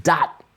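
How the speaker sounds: "Dot" punched high and cut short; truncated, staccato "Dot"; accented and short. Shown as a demonstration. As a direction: hit "Dot" hard and stop it dead. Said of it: "short, truncated".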